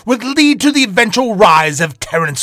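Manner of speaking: loud, grand showman storyteller voice; dramatic host narration. Acted. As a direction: announce the tale like a theatrical villain host.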